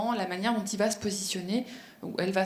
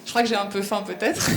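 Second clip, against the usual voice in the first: louder, breaking into a laugh; amused; 4 dB louder than usual. Real, not acted. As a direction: joke at your own expense, laugh at the end. Joke about own vegetable example.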